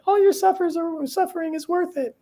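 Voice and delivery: high, sugary mocking cheerleader voice; toxic positivity voice. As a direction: parody a relentlessly upbeat guru. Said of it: "toxic positivity".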